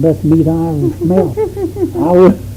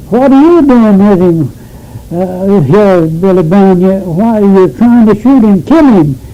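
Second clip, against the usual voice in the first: louder, raised-pitch challenge; retold confrontation; 2 semitones higher, 3 dB louder than usual. Neighbor woman confronting an armed man, retold with indignant force. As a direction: demand an explanation, acting out an indignant woman.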